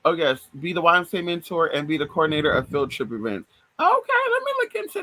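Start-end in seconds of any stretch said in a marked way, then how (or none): 3.8-5.0 high squeaky reply to a viewer's idea; high squeal. Real, not acted. Answering a viewer's YMCA suggestion, high squeaky voice.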